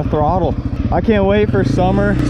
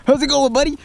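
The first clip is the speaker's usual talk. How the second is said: higher-pitched hello to a pet; greeting a dog; 6 semitones higher, faster than usual. Real, not acted. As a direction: coax the dog playfully, pitched up. Greeting his dog playfully.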